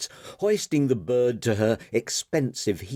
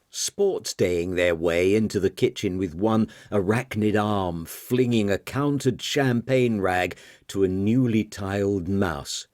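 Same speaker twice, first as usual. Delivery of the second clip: ordinary delivery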